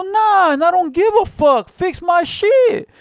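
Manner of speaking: defiant retort. Retold or imitated. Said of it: "I go".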